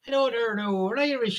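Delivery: high put-on voice mocking a plastic Irish American; hammed-up fake Irish voice. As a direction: lampoon a tourist claiming Irish roots.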